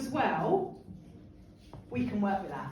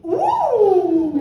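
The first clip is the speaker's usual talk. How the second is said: loud, high, drawn-out ooh; excited whoop; 7 semitones higher, 12 dB louder than usual. Real, not acted. Host reacts to the get-excited prompt, excited whoop. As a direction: whoop with anticipation.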